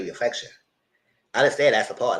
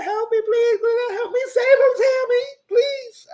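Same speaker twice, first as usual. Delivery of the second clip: much higher, louder pleading voice, drawn out at the end; hammed-up teary plea; mock begging; 4 dB louder, slower than usual. Lenice begging Tammy to save him, high and drawn out. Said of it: "He said".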